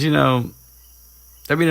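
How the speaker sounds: ordinary talk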